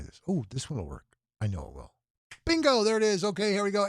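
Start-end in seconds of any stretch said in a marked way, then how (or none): 2.4-3.9 much higher and louder, triumphant; relieved burst of triumph; triumphant bingo. Real, not acted. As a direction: cheer as the login works. Password finally works.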